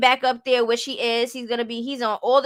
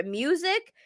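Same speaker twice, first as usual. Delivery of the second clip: "Music?" rising like a question; imitated questioning tone; 3 semitones higher, 5 dB quieter than usual. Mimicking her asking what she's here for, "Music?" as a rising question. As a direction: end "Music?" on an upward questioning lift. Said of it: "how she said it like a question".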